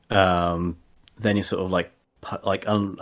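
ordinary talk

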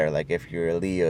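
ordinary talk

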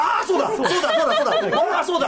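loud, high burst of sudden recognition; excited realization. Real, not acted. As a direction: cry out as it all clicks. Sudden realization about an actor.

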